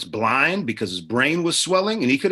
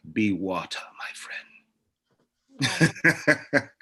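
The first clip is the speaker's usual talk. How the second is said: lightly voiced martial-arts sage; a hint of a film philosopher's measured delivery; Bruce Lee; much slower than usual. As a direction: quote the master, pausing before the last word. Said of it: "I'm like Bruce Lee".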